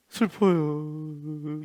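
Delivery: very slow, whiny pretend sadness; hammed-up self-pity; mock whimper. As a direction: drag it out like a sulking child. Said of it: "이러고 있는 거예요".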